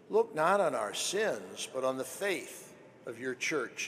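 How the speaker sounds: ordinary talk, nothing marked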